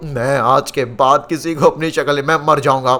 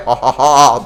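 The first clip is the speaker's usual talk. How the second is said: slow, gloating "ha ha ha"; mock evil laughter; villain laugh; 2 semitones lower, 5 dB louder, much slower than usual.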